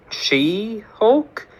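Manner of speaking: scornful high question; mocking. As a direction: sneer at the silly name. Kid mocks the name.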